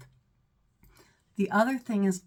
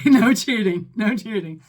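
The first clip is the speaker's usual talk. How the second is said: laughing no cheating; 11 dB louder than usual. Real, not acted. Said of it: "Don't cheat".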